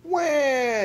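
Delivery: put-on high, loud baby wail; baby ghost yell. A narrator's reading. Baby ghost yells at the noise, wailing. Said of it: "yelled Baby Ghost".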